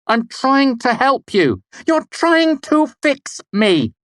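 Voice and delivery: slow, high, loud, each word bitten off; crisp and cutting; crisply. A narrator's reading. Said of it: "Denna said crisply".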